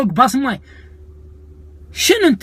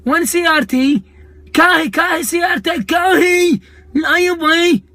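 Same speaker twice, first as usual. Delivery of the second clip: mock shout; 6 semitones higher than usual. Mocking a Kuwaiti guy shouting.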